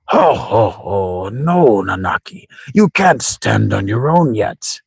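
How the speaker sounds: put-on jolly old man with swooping pitch; theatrical old sage, drawn-out words; chuckling old grandfather. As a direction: chuckle kindly, then gently refuse the youngster.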